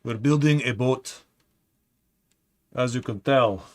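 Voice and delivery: hammed-up bad Russian accent; horrible Russian accent. Streamer joking to chat about a Soviet boat: bad Russian accent. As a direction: joke Soviet spy in a cheesy Russian accent. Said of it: "It's a horrible Russian accent".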